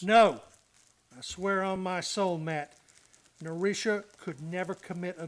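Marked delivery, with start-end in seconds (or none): 0.0-0.4 a sudden, louder flat no; emphatic denial. Acted. Clancy swears to Matt she's innocent, loud denial. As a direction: slam the door on the idea. Clancy denies Narisha could murder.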